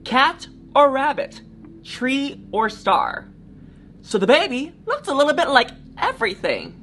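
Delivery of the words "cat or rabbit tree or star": each pair drawn out and weighed; slow dramatic list. Acted. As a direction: let every choice hang. Listing choices slowly for kids.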